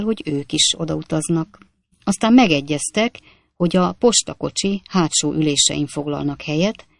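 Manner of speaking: ordinary delivery, nothing marked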